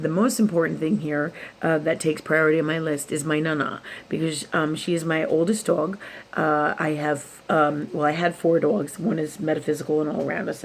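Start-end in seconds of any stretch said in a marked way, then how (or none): none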